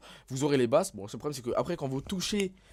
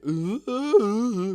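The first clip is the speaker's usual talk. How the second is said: high, loud cracking 'euh'; imitated voice change, high and loud; breaking adolescent voice; 10 semitones higher, 10 dB louder, much slower than usual. Shown as a demonstration. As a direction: squeal like a voice mid-puberty. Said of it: "qui est en train de muer".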